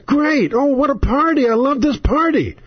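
high, sarcastic praise; mock your own party with high sarcasm. Real, not acted. Fed-up host mocking his own party, high sarcastic.

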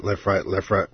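ordinary talk

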